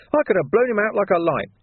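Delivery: high-pitched youthful grumble; complaining. A narrator's reading. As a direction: protest to the sergeant in a high voice. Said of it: "Sam complained".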